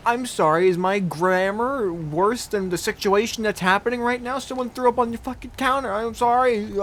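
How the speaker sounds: loud, indignant mock apology; mad retort. Pupil firing back at teacher over grammar, mock mad. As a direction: throw the apology back, worked up. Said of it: "I got so mad".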